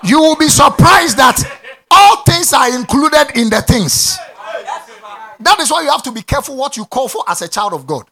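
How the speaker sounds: loud, ringing declarations from the pulpit; preaching at full force. Acted. A pastor shouting faith claims to the church, loud preaching.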